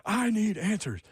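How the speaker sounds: high-pitched mock courtroom outburst; playful, overdone demand; hammed-up movie quote. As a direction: strain upward as if quoting a dramatic film line.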